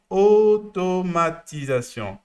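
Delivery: "Automatisation" drawn out slowly, syllable by syllable; syllable-by-syllable emphasis. Shown as a demonstration. Coach driving home the client's field, "Automatisation" spelt out slowly. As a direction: stretch every syllable of "Automatisation". Spells out the key word for client.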